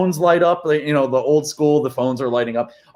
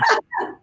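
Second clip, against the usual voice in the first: short high-pitched laugh; quick real giggle, very high. Real, not acted. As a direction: burst briefly into laughter. Host laughs after joking apologies.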